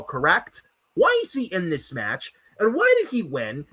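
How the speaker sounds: usual speaking voice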